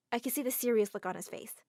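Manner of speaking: ordinary delivery, nothing marked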